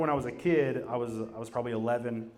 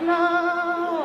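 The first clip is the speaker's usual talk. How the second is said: mock wail; 7 dB louder than usual. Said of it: "She's like".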